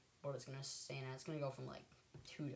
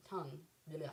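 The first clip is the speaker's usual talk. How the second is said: ordinary talk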